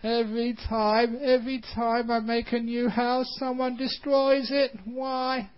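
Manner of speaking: high, plaintive complaint; whiny spider voice. Acted. Heartbroken spider asks why, high and plaintive. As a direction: wail it like a hard-done-by little spider. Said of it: "thought the spider".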